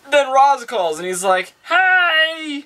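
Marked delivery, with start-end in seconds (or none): 1.6-2.6 high, drawn-out hey; hammed-up chirpy phone hello; mock cheery greeting. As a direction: sing out a put-on greeting. Villain's cheery phone greeting, mocked.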